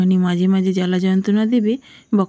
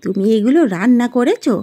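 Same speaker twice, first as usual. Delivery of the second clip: higher-pitched, indignant mother-in-law voice; scolding mother-in-law; 5 semitones higher, 4 dB louder than usual. Acted. Mother-in-law rejecting her daughter-in-law's cooking, scolding. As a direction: complain sharply, pitch leaping up on the questions.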